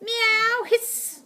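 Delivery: high meow into a hiss; acted spitting cat; attacking cats. A narrator's reading. Cats scratch at the dog, meowing and hissing.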